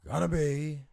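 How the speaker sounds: high, loud Pacino shout. Retold or imitated. Doing Al Pacino on the podcast, loud and high. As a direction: belt it like Al Pacino.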